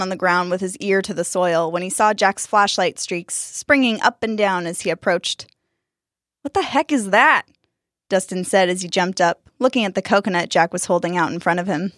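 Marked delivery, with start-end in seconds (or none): 6.4-7.5 startled, pitched-up question. Acted. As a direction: caught off guard, blurting it. Dustin startled by flashlight.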